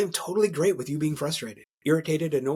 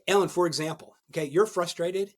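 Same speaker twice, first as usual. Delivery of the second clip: normal talking voice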